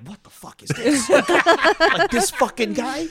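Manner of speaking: high, loud disbelief through laughter; laughing mock outrage at the kid; incredulous old champ, hammed up and laughing; stunned Joe Louis. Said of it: "he's like".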